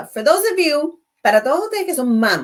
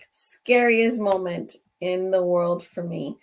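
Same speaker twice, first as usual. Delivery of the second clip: slower reflective repeat; 3 semitones lower, slower than usual. Real, not acted. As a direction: let it sink in, slowly.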